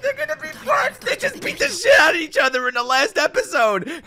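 high squeal building to a shout; hyped-up, high-pitched outburst; frantic excitement. Real, not acted. Excited reaction to coming fight.

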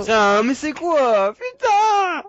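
slow, high furious yell; raging gamer. Real, not acted. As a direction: scream at the game. Dying, shouting at game.

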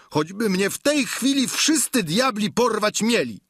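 high-pitched, defiant protest; frantic outrage, like a bellowing bull; Sancho bellowing. A narrator's reading. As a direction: holler your refusal in an agitated high voice. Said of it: "zaczął jak byk ryczeć".

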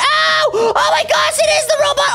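high-pitched frightened yelping; panicked. Acted. Kid spotting the robot, panicked. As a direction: cry out in alarm.

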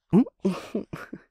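sudden high, loud burst of laughter; a loud delighted laugh breaking out. Acted.

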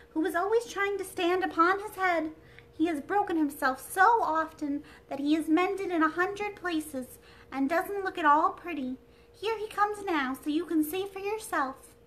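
high, loud dainty princess; a delicate china princess, high and bright; sweet airy princess voice. Acted.

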